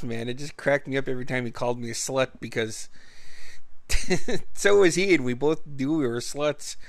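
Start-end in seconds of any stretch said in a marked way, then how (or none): 2.9-4.5 a burst of real unvoiced laughter; breathy laugh. Real, not acted. Storyteller cracking up at his friend's joke, laughing breathily.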